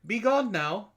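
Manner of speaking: ordinary talk, nothing marked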